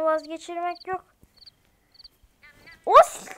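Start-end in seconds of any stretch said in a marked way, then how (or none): 2.9-3.3 startled yelp. Retold or imitated. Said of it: "Diyormuşum".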